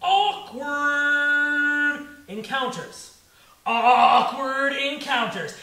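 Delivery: very slow, held vowels; drawn-out refrain. Acted. Comic announcing his theme to the crowd, drawn out. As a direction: stretch the first word out like a held note.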